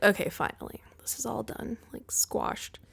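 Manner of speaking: whispered, retold sigh of relief; hushed relief. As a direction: drop to a soft whisper, as if it's over. Retells her relieved reaction, near whisper.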